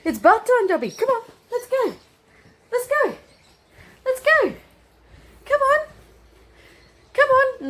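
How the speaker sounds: very high, bright coaxing calls; high-pitched, excited. Shown as a demonstration. Demo of the right way to call the dog, high excited. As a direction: cheer him along like a party. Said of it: "really high-pitched, exciting voice".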